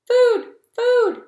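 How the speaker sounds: high, loud squeaky hatchling call; tiny gosling cry. Acted. Newborn gosling calling the robot for mama.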